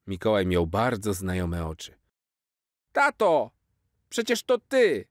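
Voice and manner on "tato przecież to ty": put-on high, loud childish outcry; a boy's letdown breaking out; disappointed cry. Jaś unmasking his dad, high disappointed cry. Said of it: "krzyknął Jaś i się rozpłakał".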